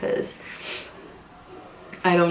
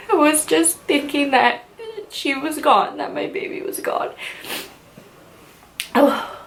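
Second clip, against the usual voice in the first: crying now; 8 semitones higher than usual. Real, not acted. Telling viewers about her lost hope while really tearing up, voice cracking. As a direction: voice high and breaking into tears. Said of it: "I know I'm gonna cry just thinking about it".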